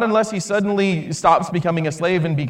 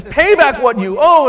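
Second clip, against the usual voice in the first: louder, higher menacing demand; threatening demand; 7 semitones higher, 8 dB louder than usual. Acted. Slave choking his fellow slave, loud threatening demand. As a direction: bark it as a threat.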